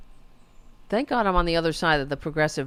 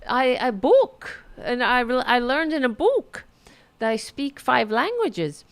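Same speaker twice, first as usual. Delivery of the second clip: higher-pitched imitation of a boastful interviewee; mock her answer, pitched up and halting; 6 semitones higher, 3 dB louder than usual. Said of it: "And she says".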